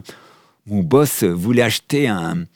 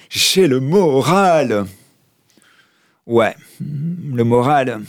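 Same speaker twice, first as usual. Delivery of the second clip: drawn-out; 3 semitones higher, much slower than usual. Acted. Mock boast about morale, drawn out.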